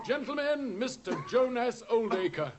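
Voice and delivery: high, ringing presentation; grand introduction. Acted. Holmes announces the culprit to the gentlemen, high and ringing. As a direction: present him like a showman.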